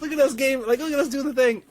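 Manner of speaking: gushing to a fellow worker, very high-pitched; excited. Retold or imitated. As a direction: bubble over with fan joy. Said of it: "I was excited".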